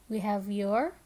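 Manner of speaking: usual speaking voice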